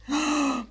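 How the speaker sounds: a quick startled intake of breath; sharp gasp. Real, not acted. Gasping when the game's mother calls. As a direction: gasp as something pops up in the game.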